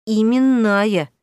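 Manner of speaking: "Именная" drawn out slowly and firmly; slow, deliberate stress on the whole word "Именная"; pointed emphasis. A narrator's reading. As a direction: press on every syllable of "Именная". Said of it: "с нажимом произнесла она".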